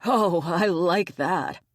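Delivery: slow, chuckling delight; amused and pleased, laughing slightly. Acted. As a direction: say it through leftover laughter. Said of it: "He burst out laughing".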